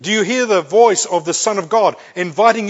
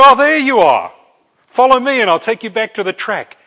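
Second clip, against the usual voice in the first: loud, higher call to someone lost; raised voice, taking charge; ranger calling out; 5 semitones higher, 8 dB louder than usual. Acted. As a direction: hail them across the bush. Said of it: "and a dock ranger".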